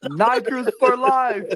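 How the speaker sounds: slow, loud and high through laughter; drawn-out laughing chant; laughing slogan. Real, not acted. Slogan said slowly while laughing.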